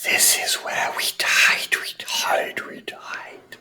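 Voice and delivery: eerie whisper fading away; ghostly whisper. Acted. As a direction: mourn it like a dead spirit. Spirits tell of their death.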